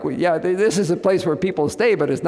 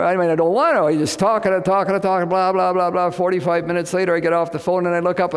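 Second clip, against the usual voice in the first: normal talking voice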